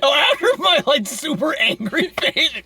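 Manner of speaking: laughing. Real, not acted. Host recalling their super angry face, laughing hard. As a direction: struggle to get it out while laughing.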